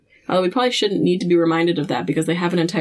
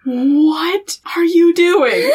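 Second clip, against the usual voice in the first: high, loud, very drawn-out disbelief; incredulous question; 9 semitones higher, 5 dB louder, much slower than usual. Retold or imitated. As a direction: imitate appalled disbelief, stretching every word. Sarah's incredulous reaction, acted.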